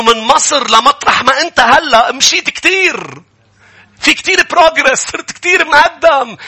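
high, loud, urging; excited exhortation. Real, not acted. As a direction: lift them up about their progress. Preacher exhorting, raised. (حماس وصوت عالي)

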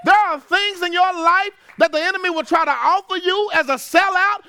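high, loud preacher's call; full-force preaching. Acted. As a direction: drive it home to the church.

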